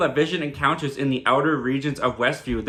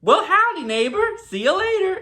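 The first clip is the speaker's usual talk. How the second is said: very high, loud, sing-out cheery greeting; overly chirpy neighbour; 4 dB louder than usual. Retold or imitated. Agnes snapped back into her role, greeting Vision in a high chirp. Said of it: "she snaps back into it".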